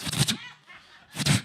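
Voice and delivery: explosive mouth-made whooshes; rapid-fire bursts of sizzle and toss; imitated wok noises. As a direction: sputter fast, loud cooking sounds. Imitates fast wok cooking.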